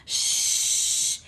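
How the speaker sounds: breath-only shushing; long hushing shh. A narrator's reading. Sleepy dragons say bedtime has come, long shush. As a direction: hush everyone with a long shh.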